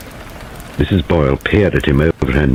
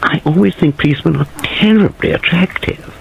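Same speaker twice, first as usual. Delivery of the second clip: much higher, shrill and gushing; lightly shrill, much higher; 10 semitones higher than usual. A narrator's reading. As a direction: coo over the policeman shrilly. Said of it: "Christopher Wren said shrilly".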